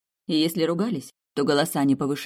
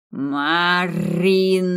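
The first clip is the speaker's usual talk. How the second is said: drawn-out, louder annoyed call of a name; displeased call; 5 dB louder, much slower than usual. Acted. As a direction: summon her sharply from another room. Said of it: "раздалось из кухни недовольное". (недовольный окрик из кухни)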